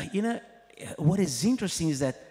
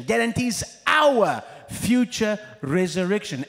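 "our" hit loud in a shouted climax; shouted emphasis; 6 semitones higher, 5 dB louder than usual. Acted. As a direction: shout "our" out to the whole church. Repeats the point louder.

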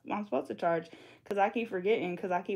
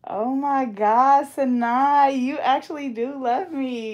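loud, slow, high gush; overwhelmed, stretch it out; a loud touched cry; moved and gushing; 5 semitones higher, 8 dB louder, much slower than usual. Real, not acted. Touched by fan's loyalty.

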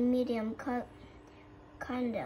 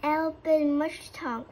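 loud, slow cry for help; 4 semitones higher, 7 dB louder, slower than usual. A narrator's reading. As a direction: howl for help, mouth on fire. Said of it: "cried Coyote".

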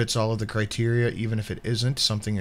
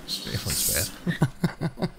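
slow, softer words through laughter; laughing admission; 4 semitones higher, 6 dB quieter, much slower than usual. Real, not acted. Host owning up to co-host about the bar, laughing. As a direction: draw it out while cracking up. Laughing over drinking story.

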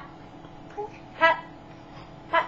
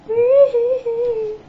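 high playful squeal; 12 semitones higher, 11 dB louder than usual. Real, not acted.

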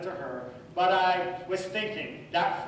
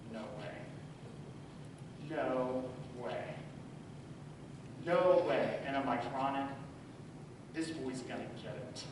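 low, much softer resolve in the dark; quiet seething; 7 semitones lower, 9 dB quieter than usual. Retold or imitated. Father lying awake in the dark, quietly seething. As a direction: hold the anger down so nobody wakes.

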